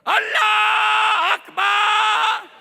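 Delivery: high, wailing, long-held shout; imagined zealot cry. Acted. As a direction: belt out a mock call, drawing out each vowel. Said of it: "was expecting him to come out and go".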